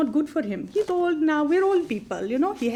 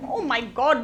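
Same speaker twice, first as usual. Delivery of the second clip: usual speaking voice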